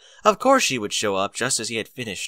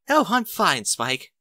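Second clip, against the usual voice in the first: high, delighted exclamation; Rarity exclaiming with delight; exclaimed; 8 semitones higher, slower than usual. A narrator's reading. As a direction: gush it with delight. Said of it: "she exclaimed".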